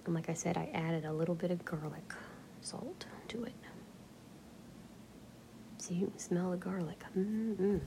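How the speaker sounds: hushed, breathy-free whisper, close to the mic; quiet whispered talk; soft whisper. Acted. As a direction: whisper it like ASMR chat. Quiet whispered chat about food.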